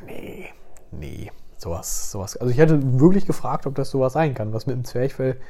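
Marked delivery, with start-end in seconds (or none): 0.1-2.4 low, slow, hushed brush-off; a hint of a doctor waving it off; dismissive doctor. Family doctor dismisses patient's worry, low slow brush-off. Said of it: "der hat dann immer gesagt".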